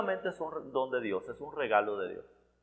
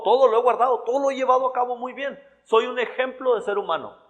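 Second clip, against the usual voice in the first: self-righteous rich man boasting of his obedience, mocked smugly; boastful mock brag; 7 semitones higher, faster than usual. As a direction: puff up like a proud rich man bragging.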